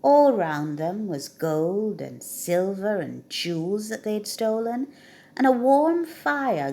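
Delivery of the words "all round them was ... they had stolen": usual speaking voice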